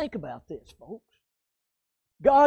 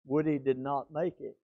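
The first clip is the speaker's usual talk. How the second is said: sad hush; 7 semitones lower, 8 dB quieter than usual. Real, not acted. Telling of friend's death.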